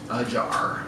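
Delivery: ordinary delivery, nothing marked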